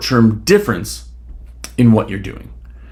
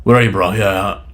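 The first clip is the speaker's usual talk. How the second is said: cocky bro; 6 semitones lower than usual. Retold or imitated. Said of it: "they're all cocky".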